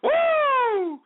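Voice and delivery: high "woo" after exertion; winded whoop. Real, not acted. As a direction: let out a high, relieved whoop. Catching breath after the dance.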